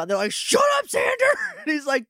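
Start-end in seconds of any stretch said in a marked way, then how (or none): 0.3-1.3 very high screamed rebuke; mock group yell. Everyone turns on Xander, mock yell. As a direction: shriek it at the newcomer, hammed up. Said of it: "What are we yelling about?".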